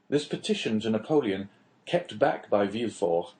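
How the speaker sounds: ordinary delivery, nothing marked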